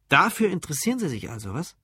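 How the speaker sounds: laugh, then rattle it off amused; amused disbelief. Acted. Curious barman teases the drunk about cloning, amused.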